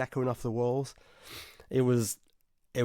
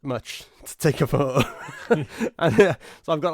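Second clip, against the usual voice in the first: laughing; 4 semitones higher, 6 dB louder than usual. Real, not acted. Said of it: "my hands were shaking".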